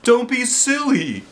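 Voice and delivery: high, slow, amused reassurance; smiling principal. Acted. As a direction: laugh off their worry. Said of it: "with a big smile".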